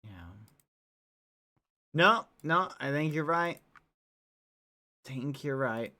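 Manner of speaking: low, loud agreeing villain; deep character voice. Acted.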